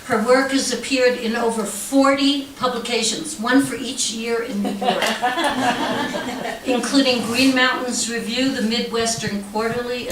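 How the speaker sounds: ordinary talk, nothing marked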